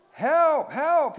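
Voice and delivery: high, loud terrified cry for help; panicked drowning boy's scream. A narrator's reading. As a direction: scream it in short, desperate bursts. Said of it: "he screamed".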